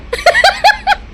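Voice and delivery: a big high shrieking laugh; high squealing laugh. Shown as a demonstration. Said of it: "जब मैं ज़्यादा हंसती ना". (ज़ोर की ऊँची हँसी)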